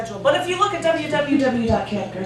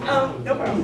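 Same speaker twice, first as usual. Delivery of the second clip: ordinary talk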